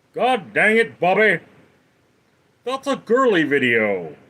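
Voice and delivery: exasperated cartoon dad; Hank Hill. Cartoon father scolding Bobby, exasperated impression. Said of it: "That was Hank".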